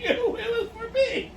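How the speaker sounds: high, slow, laughing delivery. Real, not acted. Explaining a joke only for herself, laughing through it. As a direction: let the laugh take over the line.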